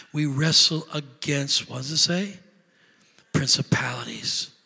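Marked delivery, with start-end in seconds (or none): 3.3-4.5 whisper. Acted. Whispered list word.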